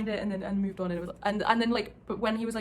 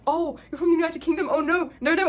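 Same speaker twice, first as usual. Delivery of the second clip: higher, louder mock panic; sudden dismayed realization; 8 semitones higher, 8 dB louder than usual. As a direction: act out spotting your own mistake. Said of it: "And then Arthur realized".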